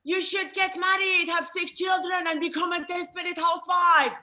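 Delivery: high-pitched nagging Iranian woman; hammed-up Iranian matchmaker voice; Iranian inner voice. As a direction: play the insistent Iranian side, pitched high. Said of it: "the Iranian in me, she's like".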